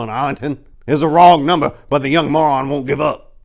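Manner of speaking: put-on loud, high riled-up old codger; cranky old-timer ranting, loud and high; raspy old man. A narrator's reading. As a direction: rant like a grumpy old man fed up with kids today. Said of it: "chimed in with his raspy voice".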